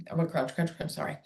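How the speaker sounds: chewing while talking; mouth full. Real, not acted. Therapist eating a Cheerio mid-lesson, mouth full. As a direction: talk through a mouthful of cereal. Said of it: "I just don't wanna talk my mouth full".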